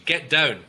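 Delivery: "Get down" loud, with a firm falling end; downward command. Shown as a demonstration. Coach showing listeners a spoken command, loud and falling. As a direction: land "down" as an order, voice dropping. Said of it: "it goes down".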